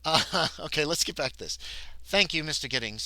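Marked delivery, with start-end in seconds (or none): none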